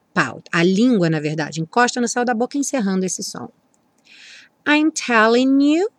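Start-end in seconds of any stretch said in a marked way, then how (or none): 4.7-5.9 slow, "telling" N joined to "you"; linked telling you. Shown as a demonstration. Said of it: "o N do telling junta com you". (N de "telling" ligado ao "you")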